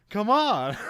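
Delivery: high, loud mock urging; big, high-pitched playful call; loud beckoning. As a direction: imitate cops eagerly calling someone over. Acting out police egging the bus on.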